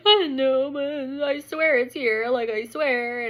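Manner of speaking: drawn-out mock tearful plea; whiny insistence. Wife swearing to husband it's here, whiny pleading. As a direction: whine it slowly, nearly wailing.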